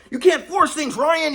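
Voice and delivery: high-pitched yell mimicking an angry grandpa; imitated yelling. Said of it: "He's yelling in my face".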